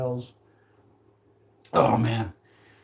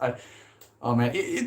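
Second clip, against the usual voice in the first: ordinary talk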